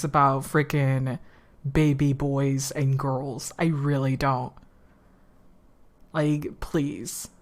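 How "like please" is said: soft unvoiced please; whispered plea. Real, not acted. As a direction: beg under your breath. Pleading aside.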